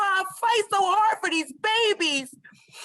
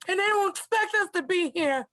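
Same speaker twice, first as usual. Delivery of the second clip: usual speaking voice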